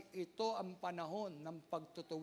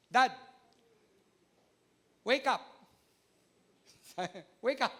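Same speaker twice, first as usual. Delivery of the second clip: a hint of a kid nudging dad awake; child waking dad; 2 semitones higher, 8 dB louder than usual. Child voiced waking father.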